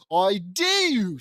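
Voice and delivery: hammed-up cry of amazement; exclaiming. Gabriella seeing her wings, hammed-up exclamation. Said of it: "exclaims Gabriella".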